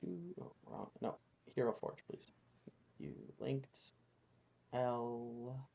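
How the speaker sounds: whispered aside. Real, not acted. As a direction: mutter it under your breath. Aside while searching.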